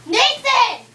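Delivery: angry. Real, not acted. Scolding friend about the fan.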